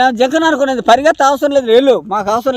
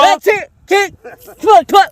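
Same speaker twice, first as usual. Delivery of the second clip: disgusted spitting; 5 semitones higher than usual. Acted. Disgust at pig cheese.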